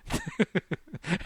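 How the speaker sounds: a short high chuckle; laughing. Real, not acted. Remembering getting thrown by the wave, high chuckle.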